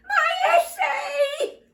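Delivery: high, loud, slow wail. Acted. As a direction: wail over the lost essay. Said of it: "wailed Perfect Peter".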